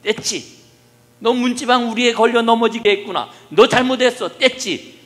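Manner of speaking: family hamming up a telling-off of the doorsill; mock scolding. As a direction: scold the threshold loudly to comfort a crying toddler. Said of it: "문지방을 향해서 막 야단을 해요".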